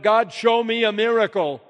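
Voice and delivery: loud, high shout to God; shouted plea. Acted. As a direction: shout it out as a full-voiced demand. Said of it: "And then I shouted".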